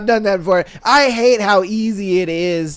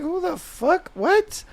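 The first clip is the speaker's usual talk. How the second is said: confused; 11 semitones higher, 6 dB quieter, slower than usual. Retold or imitated. Voicing confused texter.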